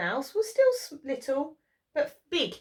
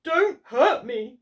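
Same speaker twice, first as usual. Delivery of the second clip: whimpering; 6 dB louder, slower than usual. Acted. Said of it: "he whimpered".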